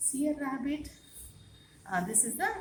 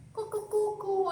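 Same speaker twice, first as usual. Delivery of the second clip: mock rooster crowing, pitched much higher; playful high cock-a-doodle imitation for the class; rooster call imitation; 8 semitones higher than usual. Shown as a demonstration. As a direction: crow like a cock for the children. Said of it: "It make noise".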